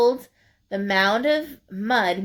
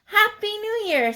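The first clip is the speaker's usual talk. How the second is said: 1 cheery greeting; 11 semitones higher than usual. Acted. Porcupine greeting.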